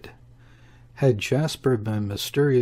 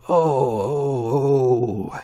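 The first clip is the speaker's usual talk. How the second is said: pained howling oh, louder; howl of fury; 2 semitones higher, 4 dB louder, much slower than usual. A narrator's reading. Heemskerk howling with fury on the veranda. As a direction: howl it out in rage. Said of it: "he howled".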